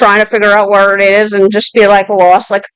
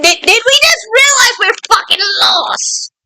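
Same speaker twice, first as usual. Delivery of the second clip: higher, half-shouted disbelief; incredulous outburst; 10 semitones higher, 3 dB quieter than usual. Real, not acted. Reader mocking the lost character, a half-shout of disbelief. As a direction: call out the character's stupidity.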